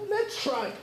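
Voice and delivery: high coaxing nudge. Retold or imitated. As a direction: coax him into trying. Coaxing the reluctant boy.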